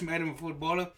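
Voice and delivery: ordinary delivery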